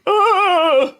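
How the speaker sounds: high, loud imitated wail of someone toppling; put-on high-pitched "whoa" yelp; mock stumbling cry. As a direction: cry out as if stumbling backwards drunk. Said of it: "stumbles back".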